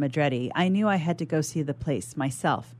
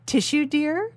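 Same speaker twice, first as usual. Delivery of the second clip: loud, high grandma offering a tissue; booming; 10 semitones higher, 3 dB louder than usual. Acted. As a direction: offer it high and a bit louder. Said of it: "boomed a loud voice".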